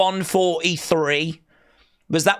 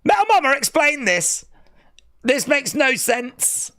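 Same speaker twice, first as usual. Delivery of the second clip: mock demand; 7 semitones higher than usual. Real, not acted. Demanding explanation.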